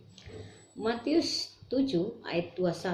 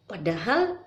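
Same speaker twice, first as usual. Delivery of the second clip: normal talking voice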